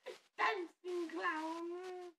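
very high, loud, drawn-out creepy clown; Pennywise. Acted. Introducing the horror clown by title, creepy squealed flourish. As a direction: let the last word wail on and on, piercingly high. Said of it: "I enjoy doing Pennywise".

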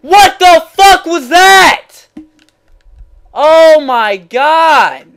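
high, slow screamed disbelief; real gamer rage. Real, not acted. Gamer outraged by what just happened, screaming. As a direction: scream it out, stretching the words. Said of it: "after raging that hard".

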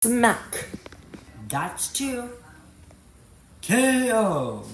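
loud hit-counting calls; announcer shout. Pretend. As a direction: call each blow like a ringside announcer. Announcing hits in a fight.

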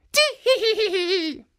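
high, loud tittering giggle; old woman giggle. Acted. Old woman hiding behind the sleeve giggling high. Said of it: "could not help laughing".

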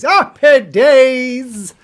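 loud, drawn-out happy days; big cheer. Pretend. Cheering the news to viewers, loud and drawn out. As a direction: belt it out slowly, stretching each word. Same words, muted then exclaimed.

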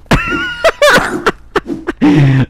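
very high, squealing laughing fit; helpless laughter. Real, not acted. Cracks up at own accent.

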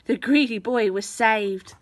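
ordinary delivery, nothing marked

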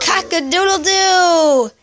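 rooster crow. Shown as a demonstration. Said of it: "what does a rooster say?".

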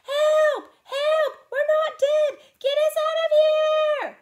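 shrieking cry for help, very high and loud; screaming. Acted. Sam screaming to the voices outside for help. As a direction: scream for rescue at full lungs. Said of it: "He screamed".

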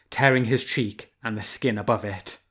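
plain reading voice

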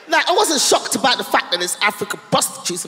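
ordinary talk

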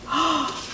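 sharp intake of breath; story gasp. Acted. Teller voices the cat's relief, soft gasp. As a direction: gasp in wonder for the listeners. Scene: story pause before thankful cat.

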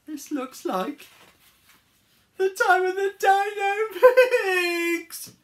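high, loud wailing horror; panicked wail. Acted. Harold finds himself among Dino Pigs, hammed-up panic. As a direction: build to a long, shrieked last word.

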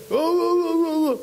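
high loud alarmed oh oh; a panicked bystander, high and loud; alarmed cry. Retold or imitated. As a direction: cry out in shock at the danger. Alarm at a child on the rail.

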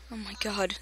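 ordinary delivery

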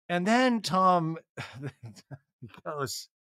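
ordinary talk

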